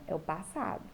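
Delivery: ordinary talk, nothing marked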